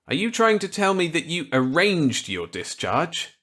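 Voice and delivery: put-on eager reply to an old colleague; Walker's voice. Walker accepting Millet's gambit eagerly.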